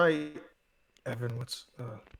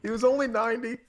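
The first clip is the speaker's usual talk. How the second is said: mock grief; 8 semitones higher, faster than usual. Joking grief for Connery.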